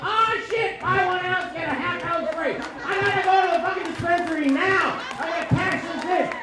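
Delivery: high, hyped-up yelling; excited outburst. Acted. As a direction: yell it like you just won. Acts out finding a coupon.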